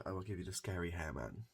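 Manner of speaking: ordinary delivery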